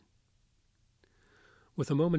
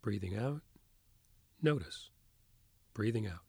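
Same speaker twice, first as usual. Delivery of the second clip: soft spa whisper; 4 dB quieter than usual. Acted. Leads a guided meditation.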